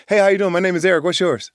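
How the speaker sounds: put-on high, loud, bouncy hello to a new kid; eager puppy greeting. Boy on a Chicago street greets other kids as an eager puppy. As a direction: greet everyone like an overjoyed puppy. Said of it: "I was like that puppy".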